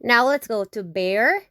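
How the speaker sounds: ordinary delivery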